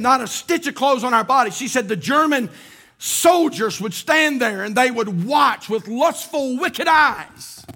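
rising to a loud preaching cry; building preaching. Acted. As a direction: build the sermon from telling to preaching at full volume. Preacher builds to full force.

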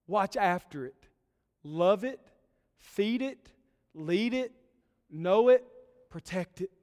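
fervent preacher calling his flock to action; preaching at full force. Acted. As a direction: build it to a pulpit-pounding climax. Rising exhortation to the shepherds.

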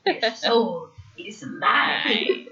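slow, eerie hissing villain whisper; cold hissing layered whisper. Retold or imitated. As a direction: drag it out like a soul-stealing sorcerer. Repeats Shang Tsung line.

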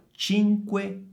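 high CINQUE, pause, CENTO, each C a ch; broken into parts. Shown as a demonstration. Teacher highlights the C for students, split CINQUECENTO. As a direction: split CINQUECENTO into two halves with crisp ch. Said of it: "Occhio in modo particolare al suono delle C".

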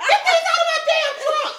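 shouted high, laughing mockery of a rapper; mock yell. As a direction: yell it like a hustler shoving CDs. Mocking rapper pushing CDs.

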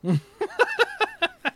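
high-pitched burst of laughter; squealing laugh. Real, not acted. Laughs at joke.

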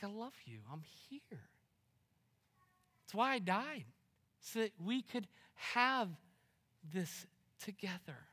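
tender hush. Pretend. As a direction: whisper love as Jesus. Voicing Jesus in tender hush.

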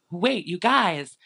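high, drawn-out mock alarm; Lala. Host imitates Lala alerting the party to the cops, urgent and high. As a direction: imitate someone urgently getting everyone's attention. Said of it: "had to, like, divert attention".

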